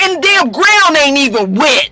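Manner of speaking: put-on indignant LA-accented complaint; imitated LA man in disbelief; mock LA street accent.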